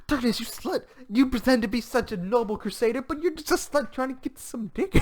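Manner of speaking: high-pitched, gasping scandalised voice; mock outraged accusation. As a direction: imitate a scandalised character calling someone out.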